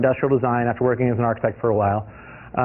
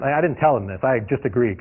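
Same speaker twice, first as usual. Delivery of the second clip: usual speaking voice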